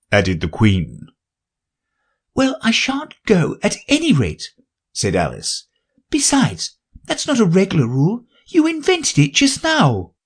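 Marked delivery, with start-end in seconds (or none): 2.3-10.1 put-on high, girlish protest; Alice tells the king his rule is invented, high and indignant. A narrator's reading. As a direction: stand up to the king with spirit.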